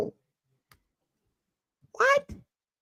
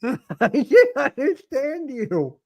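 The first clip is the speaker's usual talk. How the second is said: laughing; 10 semitones higher, 4 dB louder, slower than usual. Real, not acted. Laughing at mumbled talk.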